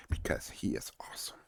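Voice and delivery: unvoiced soft whisper; whispered aside. Real, not acted. Host whispering praise of a friend. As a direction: lean in and whisper it.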